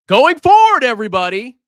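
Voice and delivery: high, loud proclamation; announcing. Real, not acted. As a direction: declare the new rule to everyone. Announcing a new rule to chat.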